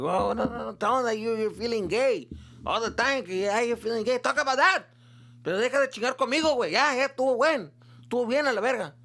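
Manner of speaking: imitating angry dad. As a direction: play the dad telling him to knock it off. Dad voiced in retold argument.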